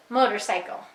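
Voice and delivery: "motorcycle" blended into one word; said fast. Shown as a demonstration. Teacher giving the child's answer, "motorcycle" joined up. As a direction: run "motorcycle" together with no gap. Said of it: "Say it fast".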